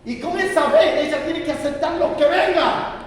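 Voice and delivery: high, loud, slow anguished prayer; tearful clamor. Retold or imitated. Father on his knees crying out to God, tearful loud clamor. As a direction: cry out to God through tears. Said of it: "con lágrimas en los ojos y con clamor".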